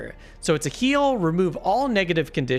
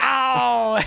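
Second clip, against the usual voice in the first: high yelp of pain, laughing; playful ow of pain; mock pained yelp; 7 semitones higher, 4 dB louder than usual. Acting out pulling hamstring.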